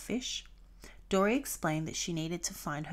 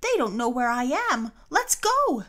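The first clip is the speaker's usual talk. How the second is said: fast, loud, rising to a high cry; Dory loudly urging her friends to go; urgent excitement; 5 semitones higher, 8 dB louder than usual. A narrator's reading. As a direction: rush it out, louder and higher at the end. Dory urgently insisting they leave.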